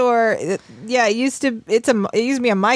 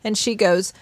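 ordinary delivery

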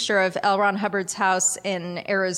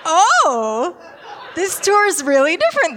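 high, loud startled reaction; surprised; 9 semitones higher, 7 dB louder than usual. Retold or imitated. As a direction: sound caught off guard. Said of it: "And we're like".

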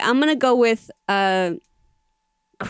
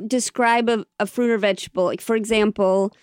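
ordinary talk, nothing marked

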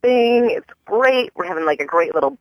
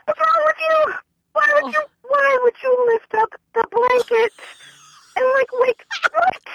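exasperated high rant. Real, not acted. Angry rant at stranger.

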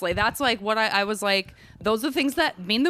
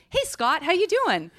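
high, chirpy mock greeting; friendly greeter; 9 semitones higher than usual. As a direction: act out a casual hello, pitched up. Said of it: "and they say".

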